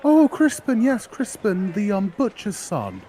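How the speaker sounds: put-on a cheerful girl pushing her flowers; eager flower girl. Flower girl offering her flowers to the adventurer, high and eager.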